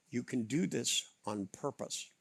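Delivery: ordinary delivery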